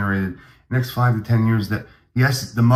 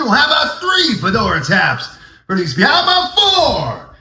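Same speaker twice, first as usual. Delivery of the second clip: hammed-up excitement; 10 semitones higher, 3 dB louder than usual. Ups the offer, hammed up.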